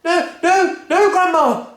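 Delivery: very high, loud pleading; panicked boy. Acted. George refusing Grandma, panicked high cry. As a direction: pull away in panic. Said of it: "George cried out".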